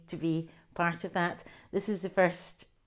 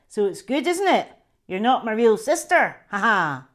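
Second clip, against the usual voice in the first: gloating brother; 4 semitones higher, 10 dB louder than usual. Acted.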